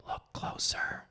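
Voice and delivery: soft, breathy menacing whisper. A narrator's reading. You whispering close to his ear before the kill, whisper. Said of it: "you whisper".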